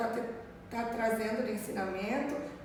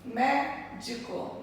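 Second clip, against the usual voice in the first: Médico drawn out slowly, syllable by syllable; teacher splitting the word into syllables; much slower than usual. Shown as a demonstration. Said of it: "Primeira, segunda, terceira sílaba".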